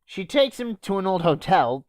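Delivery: normal talking voice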